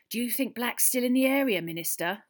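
ordinary delivery

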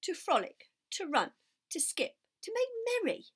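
bubbling with excitement; eager piglet. Acted. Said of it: "said Wilbur".

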